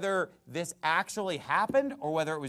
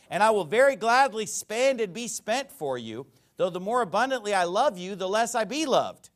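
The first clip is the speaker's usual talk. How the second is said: loud, raised-pitch sarcastic rebuke; 5 semitones higher, 6 dB louder than usual. Acted. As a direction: declaim it hard, with sarcasm. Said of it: "We'll see some more sarcasm".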